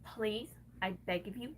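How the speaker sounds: ordinary talk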